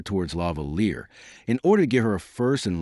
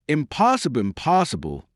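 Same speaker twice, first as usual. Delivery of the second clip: crying out; 7 semitones higher than usual. A narrator's reading. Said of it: "she cried".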